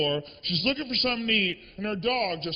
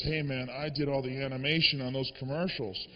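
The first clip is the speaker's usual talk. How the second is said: much lower, quiet stoner guy; laid-back animator; 9 semitones lower, 8 dB quieter than usual. Retold or imitated. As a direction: mellow and unbothered, a lightly voiced stoner. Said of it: "He goes".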